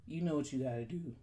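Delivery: normal talking voice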